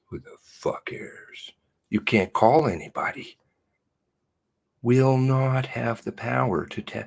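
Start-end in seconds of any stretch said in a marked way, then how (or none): 0.1-1.5 whispered aside. Real, not acted. Muttered aside while reading news.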